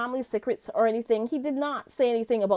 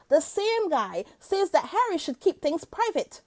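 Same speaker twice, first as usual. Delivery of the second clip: ordinary talk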